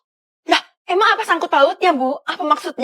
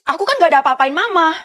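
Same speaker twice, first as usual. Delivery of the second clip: normal talking voice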